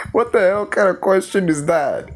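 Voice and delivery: high-pitched exasperated disbelief; incredulous. Real, not acted. Incredulous at the movie line.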